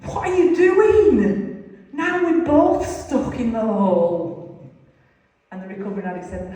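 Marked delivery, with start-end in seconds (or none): 0.0-4.7 much higher and louder, slow disbelieving protest; exasperated outburst. Acted. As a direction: throw up your hands: what have you done. Man in hole scolds rescuer.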